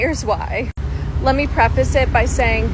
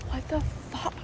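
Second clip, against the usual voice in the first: near-whispered disbelief; breathy mock disbelief; 3 semitones higher, 11 dB quieter than usual. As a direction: mouth it under your breath, barely voiced. Privately reacting to pain on plane.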